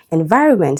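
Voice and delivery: "Environment" stressed on VI; second-syllable stress. Shown as a demonstration. Teacher giving another root word, second-syllable stress. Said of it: "second syllable".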